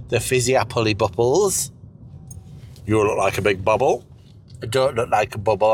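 ordinary delivery

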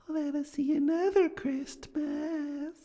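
high, slow otherworldly voice; eerie high ghost asking gently; ghostly spirit. Acted. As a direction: float it up like a spirit. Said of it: "The ghost smiled thoughtfully".

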